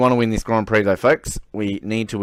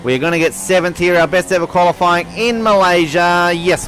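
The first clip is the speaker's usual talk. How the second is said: excited; 7 semitones higher, 5 dB louder than usual. Real, not acted. Celebrating a good qualifying.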